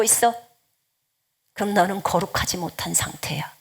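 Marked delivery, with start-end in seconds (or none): 1.5-3.5 훨씬 작게 숨소리로 속삭이는 꾸짖음; 조용히 찌르듯 속삭여; 속삭임. Real, not acted. Rebuke delivered in whisper. (회중에게 경고하며 낮게 속삭이듯)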